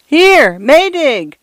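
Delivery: a small voice screaming against the gale; feeble scream. A narrator's reading. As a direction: scream the name into the storm. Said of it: "screamed Mister Fotheringay's feeble voice".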